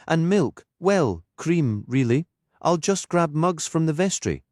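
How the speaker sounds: a lightly voiced Geordie lilt, proud of her thermos; vicar's voice. Anna fusses over the coffee.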